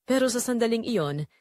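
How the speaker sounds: ordinary delivery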